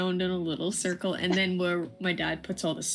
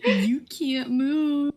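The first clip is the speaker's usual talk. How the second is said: amused disbelief; 7 semitones higher, 5 dB louder, much slower than usual. Real, not acted. Laughing at dog in snow.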